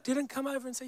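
ordinary delivery

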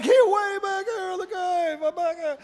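high, soft call from far away; distant call. Acted. Man hiding in his nothing space, calling distantly. Calling from deep inside cave.

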